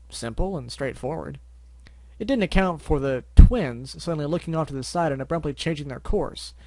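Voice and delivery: ordinary delivery, nothing marked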